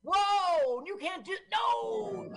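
very high-pitched cry of disbelief; high, hammed-up outraged yelling; mock horrified protest. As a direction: wail in exaggerated horror. Acts horrified reaction to the film.

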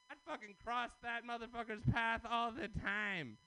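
ordinary talk, nothing marked

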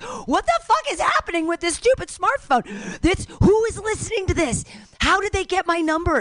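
ranting at a spying smartphone in mock outrage. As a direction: rant at the phone, aghast.

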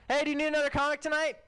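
fast, high holler; quick high-pitched call. Real, not acted. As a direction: shout the question across the room. Calling across the room.

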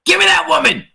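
mock villager shout. Acting out a villager grabbing the witch.

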